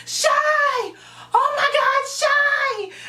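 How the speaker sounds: very high, loud alarmed yell; retold panicked shout. Friend calling out to the girl rolling away, alarmed shout. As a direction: yell the name in acted panic.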